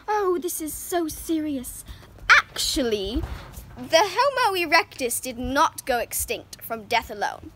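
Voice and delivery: ordinary talk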